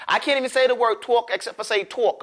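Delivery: normal talking voice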